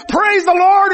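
high, loud exclamation of praise; praising shout. Retold or imitated. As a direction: burst out praising. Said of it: "And she says".

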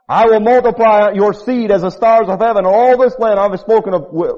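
high, loud pleading scripture; preaching at full force. Acted. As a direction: plead boldly with God. Continues Moses' plea.

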